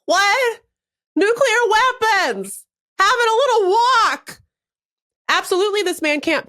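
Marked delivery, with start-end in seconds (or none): 0.1-4.4 mock yelling Trump. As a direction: yell it out like a doddering old man, hammed-up.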